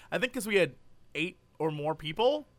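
normal talking voice

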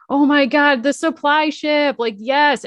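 high-pitched mock elation; excited retelling. Girl seeing the supply ship at last, excited. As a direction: exclaim it like the awaited ship arrived. Acting out girl's joy at ship.

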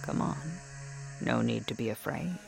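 coaxing. Acted. Shopkeeper coaxing softly.

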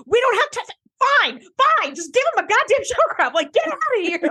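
high, exasperated Riker giving in; Riker caving in. Retold or imitated. Podcaster imitating Riker shooing Moriarty off, high and exasperated. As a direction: throw up your hands and wave them off, pitched high. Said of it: "I love that Riker's like".

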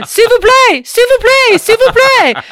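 loud, high tourist yelling S'il vous plaît over and over; screamed French. Retold or imitated. Loud American tourist screaming in France. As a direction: scream it like a tourist who knows one word. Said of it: "keep screaming that word over and over".

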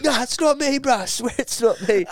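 high-pitched defensive denial; mock protest. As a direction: imitate a lad pleading innocence. Lad denying the accusation.

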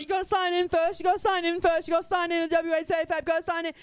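high, loud, repetitive pestering; shop ladies insisting. Retold or imitated. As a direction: badger him as a crowd of clerks. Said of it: "just surround him and".

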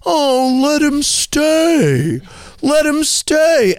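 high, slow, coaxing diva voice. Retold or imitated. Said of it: "And she said".

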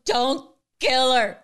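slow, hammed-up tearful plea; fake-sob it like a begging fan; mock pleading cry. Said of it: "run around and go".

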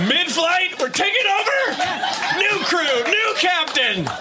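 high, laughing hijacker takeover. Retold or imitated. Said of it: "describing the role of a hijacker".

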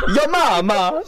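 hammed-up Italian accent, high. Joking with a friend in mock Italian accent. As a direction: fling it like a cartoon Italian. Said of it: "the Italian accent".

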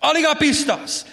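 high, chiding voice of Jesus repeating the word; Jesus scolding. Retold or imitated. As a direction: scold like a disappointed teacher. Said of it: "he constantly says it to his disciples".

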